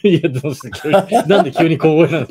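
loud, amused question through laughter; laughing. Real, not acted. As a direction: burst out laughing as you ask. Host amused by guest's sudden hush.

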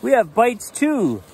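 eager cubs calling out; excited call. Acted. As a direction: call it out proudly. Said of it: "called Brother, Sister, and Honey".

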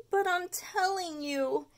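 put-on pleading boy. Stuart protesting as he is led away, whiny. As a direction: plead innocence slowly. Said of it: "said Stuart as he was led".

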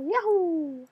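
high, bright-eyed cheering whoops; high inner child voice. Acted. Player whooping through the level as his inner child, in a high voice. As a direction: whoop like an overexcited little kid. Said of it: "back to my regular voice".

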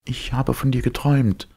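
high, dreamy heroine voice; recounting a dream with longing; dreamy young woman. Acted. Elita voiced by narrator. (verträumte, hohe Frauenstimme)

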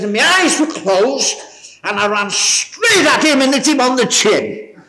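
high, slow, laughing retelling; chuckling through the story; laughing while retelling. Retold or imitated. Preacher retelling to the congregation, laughing.

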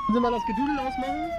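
high, loud call over the music; calling out loudly. Acted. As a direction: shout over the noise. Complains about the music, calling out. (laut rufend)

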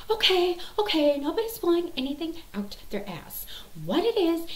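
high-pitched, exasperated mom in an argument; retold quarrel. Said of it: "they start, you know, yelling".

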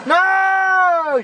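high, drawn-out wail; high cry of dismay. Real, not acted. Player caught out in the chase, high dismayed cry. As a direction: cry out high as it all goes wrong.

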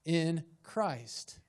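"in" and "Christ" spaced apart deliberately; measured, emphatic "in... Christ"; stress on IN CHRIST. Shown as a demonstration. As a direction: pause between "in" and "Christ", weighting each. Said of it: "The emphasis is on".

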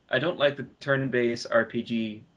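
ordinary delivery

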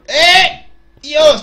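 sudden high yell; loud shout. Real, not acted. Sudden outburst in game.

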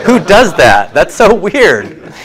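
laughing disbelief. Real, not acted. Teacher amused by [name]'s llamas, laughing disbelief. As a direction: giggle at how odd it is.

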